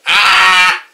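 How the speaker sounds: screaming. Acted. Sister screaming to wake up her brother, piercing. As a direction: shriek at full volume. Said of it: "Yes, Mark, I'm screaming".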